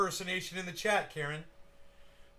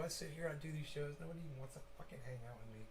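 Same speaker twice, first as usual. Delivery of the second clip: fast mutter; 11 dB quieter, much faster than usual. Real, not acted. Complaining about the audience.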